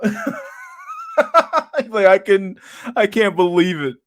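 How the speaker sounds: high, loud, breaking into laughter; laughing quote. Real, not acted. Streamer cracking up while quoting a meme kid, laughing. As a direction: squeeze the words out through laughter.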